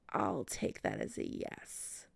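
low teasing whisper. Acted.

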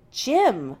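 firm, loud insistence. Acted. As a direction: say it back just as firmly. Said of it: "Jim repeats just as firmly".

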